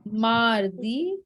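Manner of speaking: slow, separated French days with rising ends; teacher's measured pronunciation model; careful dictation. Shown as a demonstration. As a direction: say each word deliberately for students writing it down. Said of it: "write down the pronunciation".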